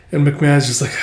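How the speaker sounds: usual speaking voice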